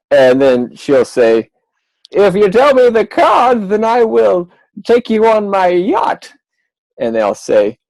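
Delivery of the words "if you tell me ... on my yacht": queen voice. Acted. Magician voicing the queen asking the deck. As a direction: play the snobbish old queen.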